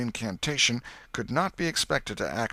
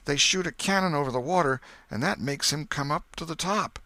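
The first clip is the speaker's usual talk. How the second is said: put-on higher, louder boyish voice, eager to explain; excited boy; 6 semitones higher, 3 dB louder than usual. Huck telling the others about the drowning, higher and eager. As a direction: spill the story out like an eager boy.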